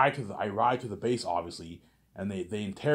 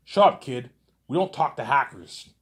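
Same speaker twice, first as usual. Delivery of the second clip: louder mock cop bark; 5 semitones higher, 7 dB louder than usual. Cop line repeated, louder.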